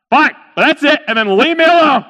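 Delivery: high, loud, fed-up snap; exasperated giving in. Acted. Merchant to pestering camel, exasperated. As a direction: give in loudly, patience gone.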